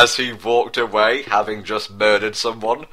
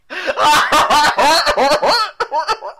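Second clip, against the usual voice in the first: high hammed-up villain cackle; evil cackle; 4 dB louder than usual. Villain laugh.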